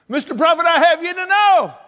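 high, loud acted protest; hammed-up widow's outburst; indignant retort. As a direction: set the prophet straight at full voice. Restarts the widow's retort emphatically.

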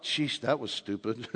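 low, soft, slow self-talk with a laugh; thinking aloud after driving away, a low amused mutter; muttered to himself. Retold or imitated. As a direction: mutter it ruefully under your breath. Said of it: "I thought".

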